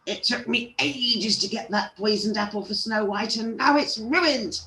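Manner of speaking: high-pitched wicked crone; witchy old-woman complaint, high and building; screeching old lady. A narrator's reading. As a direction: wail it crossly, rising to a screech. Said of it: "screeched the old lady".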